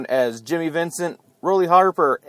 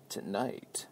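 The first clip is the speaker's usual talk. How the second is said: a breathy whispered tonight; 7 semitones lower, 18 dB quieter than usual. Real, not acted. Host whispering the word tonight to listeners. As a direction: drop to a whisper on the last word.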